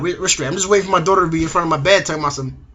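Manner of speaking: ordinary talk, nothing marked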